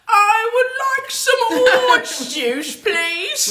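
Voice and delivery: high, slow mock-English lady, laughing through it; posh English accent. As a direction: ape a proper English woman ordering juice. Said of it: "I don't even know what accent that is".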